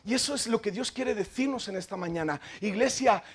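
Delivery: normal talking voice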